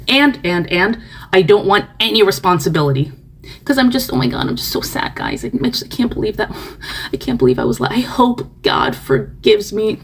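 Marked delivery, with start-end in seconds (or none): 3.6-10.0 higher, softer, half-whispered fake sadness; mock sob-story voice. Mocking the crying video poster with fake sadness. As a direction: play up fake tears, lightly.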